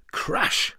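breathy whispered crash; crash sound effect. A narrator's reading. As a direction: hiss out the crash like a noise.

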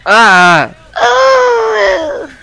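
sudden high, loud pained scream; real scream. Real, not acted. Gamer screams as a zombie grabs him. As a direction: cry out as the zombie hits.

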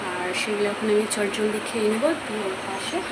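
soft, subdued voice beside a sleeper; speaking softly. Real, not acted. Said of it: "আস্তে আস্তে কথা বলছি যাতে ও ডিস্টার্ব না হয়".

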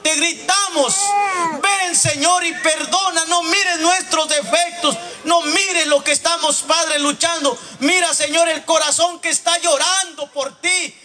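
high, loud pleading cry to God; impassioned shouted prayer. Real, not acted. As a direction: pray at full force, begging for your sons. Prayer at full force.

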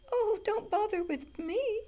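loud, very high squeal; squealing little goat. A narrator's reading. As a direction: plead in a tiny squealed goat voice. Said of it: "squealed the youngest billy goat gruff".

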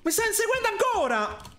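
high panicked complaint; being chased. Real, not acted. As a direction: panic while running away.

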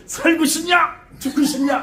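high, loud threatening shout; suddenly bully the listeners as a possessed shaman; mock browbeating. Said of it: "막 욱박지르는 거야".

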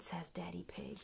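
plain reading voice

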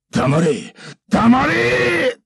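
loud, climbing to very high yell; furious shout. Acted. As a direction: scream it in rising rage. Samurai in rage.

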